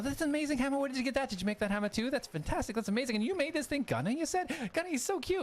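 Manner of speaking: high, gushing admiration; high-pitched apprentice, thrilled by the armor; Jonas, delighted. Acted. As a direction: marvel at the craftsmanship. Jonas gushes over hammer.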